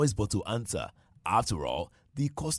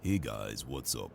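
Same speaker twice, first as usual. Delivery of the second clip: very low, softer radio voice; deep baritone; 12 semitones lower, 8 dB quieter than usual. Acted. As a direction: drop into a deep, quiet baritone. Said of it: "I want to hear that deep baritone voice".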